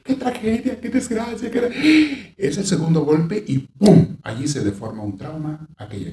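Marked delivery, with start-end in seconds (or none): 0.0-2.3 high, theatrical lament; dramatic wailing. Retold or imitated. Imitating a relative turning a death into drama, high theatrical wail. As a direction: ham up the tragic mourner. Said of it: "alguien empieza con que".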